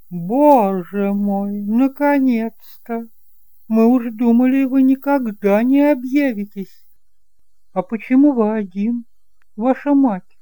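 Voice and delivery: high, loud voice of the aunt welcoming her nephew; aunt Eloi, overjoyed. Acted. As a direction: exclaim like a relieved aunt at last. Aunt greets long-lost nephew.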